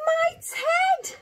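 high-pitched storybook gasp of shock. Acted. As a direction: gasp it out very high, as the startled bear. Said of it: "gasped the bear".

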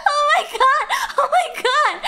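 high loud repeated exclaim; excited exclaim. Real, not acted. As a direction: keep shouting in delight. Still amazed.

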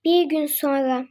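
low, slow narration; storyteller voice; narrator aside. A narrator's reading. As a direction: announce the time skip. Narrator time jump.

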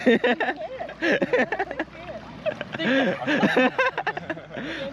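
helpless, long giggling; long high laugh. Real, not acted. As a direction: laugh through the attempt. Trying to float upside down.

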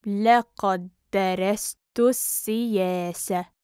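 measured, careful teaching pace. Shown as a demonstration. Teacher repeats phrase slowly joined.

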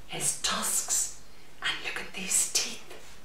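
hushed spooky whisper. Acted. Whispering to the children about the Gruffalo's teeth. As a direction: lean in and whisper to thrill. Showing scary puppet features.

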